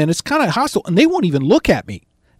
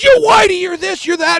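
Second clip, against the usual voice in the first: high-pitched angry tirade; cussing heckler; 12 semitones higher, 4 dB louder than usual. Acted. Heckler cussing at the visitor, angry high shout. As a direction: rattle off the accusations in a high yell. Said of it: "he started cussing at me".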